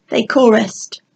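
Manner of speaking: usual speaking voice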